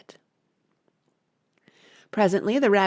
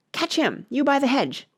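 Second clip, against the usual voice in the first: shouted order; 7 semitones higher than usual. A narrator's reading.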